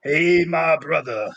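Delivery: slow, drawn-out soulful greeting. Retold or imitated. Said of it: "and he goes".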